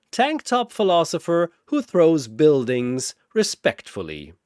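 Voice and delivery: plain reading voice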